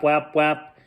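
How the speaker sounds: plain reading voice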